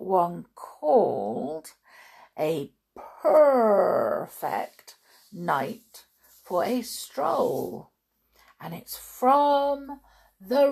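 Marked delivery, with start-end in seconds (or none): 3.2-7.9 "Perfect" very stretched, rolling into a purr; purred pun on Perfect. Shown as a demonstration. Reader announces the story title to children, stretched purring "Perfect". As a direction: draw out "Perfect" like a long purr, perrrfect.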